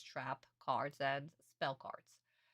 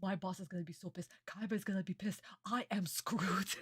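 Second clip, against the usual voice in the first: panicked whispered swearing; panicked worker; 2 semitones higher than usual. Retold or imitated. Worker panicking about the angry boss, whispering fast. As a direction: whisper the curses in a mock panic. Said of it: "you, as a worker, go like".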